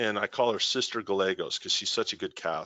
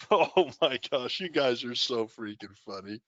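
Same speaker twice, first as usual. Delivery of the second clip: laughing. Real, not acted. Laughing at viewer comments.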